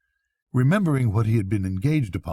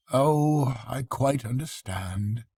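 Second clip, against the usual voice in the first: calm a trembling friend; soothing; 3 semitones higher, slower than usual. Acted. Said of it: "said the rat soothingly".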